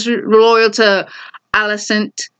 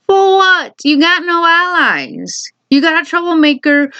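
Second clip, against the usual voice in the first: incredulous scoff; 8 semitones higher than usual. Real, not acted. Recapping the show, scoffing at a hopeless war plan, incredulous. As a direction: pitch jumps way up, then slows on the put-down. Ranting about Allison declaring war.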